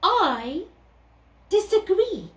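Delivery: a haughty cat. Acted. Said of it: "said the cat".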